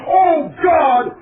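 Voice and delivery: loud, high mock yell; retold scream. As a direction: shout it out like you just saw a ghost. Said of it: "This guy comes screaming".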